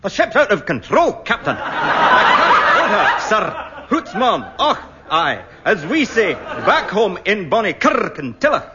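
hammed-up Scots engineer; a weak attempt at a Scottish brogue; bad Scottish accent. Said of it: "was terrible".

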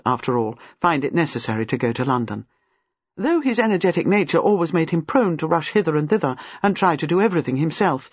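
plain reading voice

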